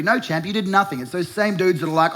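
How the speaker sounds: normal talking voice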